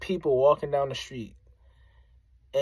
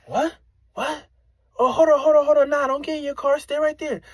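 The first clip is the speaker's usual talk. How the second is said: much higher, louder offended yell; offended challenge; 11 semitones higher, 5 dB louder than usual. Retold or imitated. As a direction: call him back with a high, loud challenge. Offended stranger confronts him.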